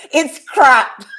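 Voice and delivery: amused, hammed-up Scottish outrage; irate Scottish. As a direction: imitate an angry Scot's blunt verdict. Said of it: "with a Scottish irate".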